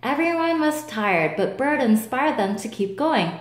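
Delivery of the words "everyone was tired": ordinary delivery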